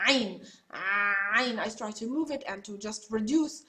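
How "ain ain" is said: Ain squeezed from the throat; Arabic Ain. Shown as a demonstration. Teacher demonstrating the Arabic letter, throat squeezed. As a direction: Ain with a choked throat catch. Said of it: "the place where we pronounce the letter".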